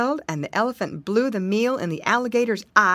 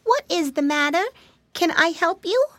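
put-on high, childlike piggy voice; a small high voice, offering help; little piglet; 9 semitones higher than usual. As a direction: pitch it up like a small, helpful piglet. Flop the piglet speaks politely.

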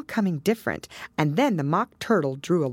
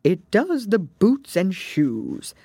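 slow, weighty pronouncement, pitched up; very solemn; 5 semitones higher, 4 dB louder, slower than usual. A narrator's reading. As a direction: announce it slowly with great solemnity. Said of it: "the Gryphon replied very solemnly".

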